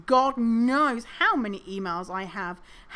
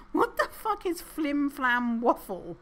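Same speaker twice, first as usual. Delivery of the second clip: baffled; 5 semitones higher than usual. Real, not acted. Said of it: "baffled reaction".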